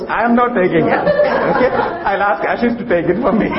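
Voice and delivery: laughing. Real, not acted. Joking after the gas-note punchline.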